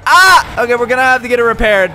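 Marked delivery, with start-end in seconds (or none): 0.1-0.6 short, high, loud yelp; startled scream. Real, not acted. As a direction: scream at a sudden crash. Crashes the car.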